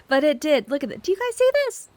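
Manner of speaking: very high excited squeal. Real, not acted. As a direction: squeal with delight to viewers. Excited about the sky.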